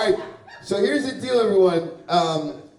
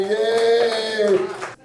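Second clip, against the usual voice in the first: long, held-out, higher cheer; mock cheer; 4 semitones higher than usual. Comic acting out the mic-drop farewell, drawn-out cheer. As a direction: stretch one sustained hooray to the crowd.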